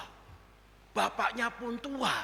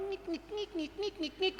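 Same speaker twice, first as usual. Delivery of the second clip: high, soft squeaking ngik; the old motorbike squeaking along; imitated squeak; 10 semitones higher, 6 dB quieter than usual. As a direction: copy the creaky bike rhythmically. Motorbike squeaking while riding.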